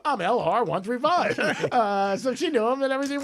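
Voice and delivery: punchline giggled through; half-laughing repeat. Retold or imitated. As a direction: chuckle the account number.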